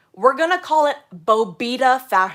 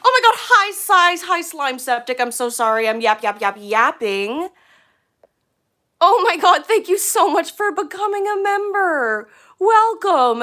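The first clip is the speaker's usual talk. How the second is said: excited greeting; 8 semitones higher, 7 dB louder than usual. Real, not acted. Greeting new members on stream.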